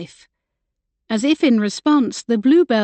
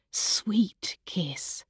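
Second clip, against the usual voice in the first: plain reading voice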